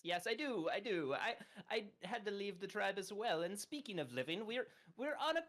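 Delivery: high, eager, rushing fairy voice; excited high character voice. Acted. Hubert excitedly greeting the ox at the front desk, high and eager. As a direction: gush over meeting a fellow tribesman.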